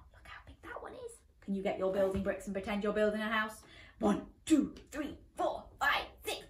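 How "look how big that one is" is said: whisper. Real, not acted. Aside to child, whispered.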